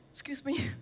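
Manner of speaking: barely gets the words out in disbelief; shocked. Retold or imitated. Told the dish is throat, quietly stunned.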